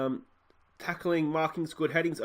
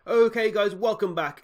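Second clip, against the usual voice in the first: much higher, louder welcome; upbeat intro; 8 semitones higher, 9 dB louder than usual. Acted. Host opens the new episode to viewers, loud and high. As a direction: greet the viewers with a burst of energy.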